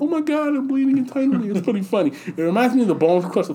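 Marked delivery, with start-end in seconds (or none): 0.0-1.5 high whiny cry; victim impression. Guy punched in the stomach crying out high. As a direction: whine it out high. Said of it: "He's like".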